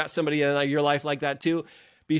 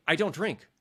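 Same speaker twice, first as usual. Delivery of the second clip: firm; 4 semitones higher than usual. Shown as a demonstration. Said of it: "I say".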